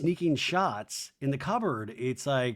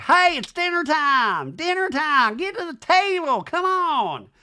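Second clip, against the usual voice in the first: high, loud, sing-song call; mock mom calling to dinner; 14 semitones higher, 7 dB louder than usual. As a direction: holler everyone to the table. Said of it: "Even Brittany's like".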